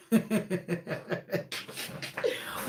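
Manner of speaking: long, soft, breathy giggle; real, drawn-out quiet laughter; amused laugh. Real, not acted. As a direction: let out a quiet run of chuckles. Laughs at her own retelling.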